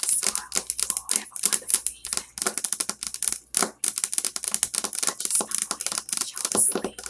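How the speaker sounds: soft sleepy ASMR whisper, barely voiced; gentle bedtime whisper for listeners. Shown as a demonstration. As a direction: lull them to sleep in a whisper. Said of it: "All right, so I'm whispering".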